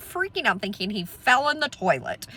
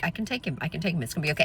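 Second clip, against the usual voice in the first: reassuring; 5 semitones lower, 7 dB quieter, much faster than usual. Retold or imitated. Reassuring frightened daughter in the car.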